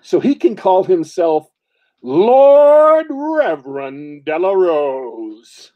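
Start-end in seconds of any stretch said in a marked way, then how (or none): 2.0-5.7 slow, loud, drawn-out herald proclamation; pompous title announcement. Pretend. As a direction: announce the friend's new title with mock pomp. Grand mock title for friend.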